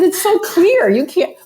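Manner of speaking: can't believe he doesn't get it; incredulous. Retold or imitated. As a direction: pitch jumps up in disbelief at the musician. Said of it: "I said".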